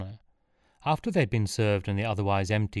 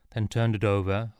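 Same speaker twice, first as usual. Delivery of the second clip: ordinary delivery, nothing marked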